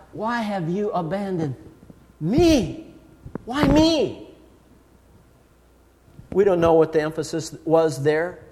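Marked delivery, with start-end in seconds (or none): 0.1-4.3 soft lead-in, then "me" set apart and raised; pause, then lift and stretch "me"; stress on ME. Shown as a demonstration. Preacher asking what Jesus emphasized, plaintive stress on "me". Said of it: "Or did he emphasize".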